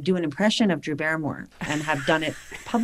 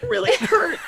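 pained whine; 13 semitones higher than usual. Retold or imitated. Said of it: "I'm over there like".

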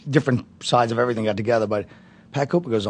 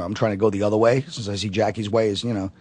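normal talking voice

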